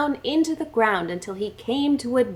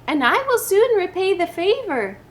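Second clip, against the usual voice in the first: snake's promise; 7 semitones higher than usual. Acted. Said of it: "cried the snake".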